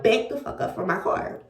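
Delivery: the same threat murmured between prayers; under her breath. Retold or imitated. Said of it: "Even though I'm praying at the same time".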